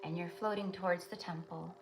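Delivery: soft, steady meditation voice; guided meditation. Acted. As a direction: lead them gently into the vision.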